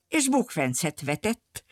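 plain reading voice

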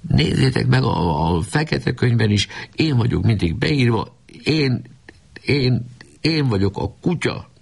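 tearful, high, breaking plea; boy sobbing to his laughing friends; crying while speaking. A narrator's reading. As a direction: sob it out, voice climbing. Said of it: "sírás közben ezt mondta".

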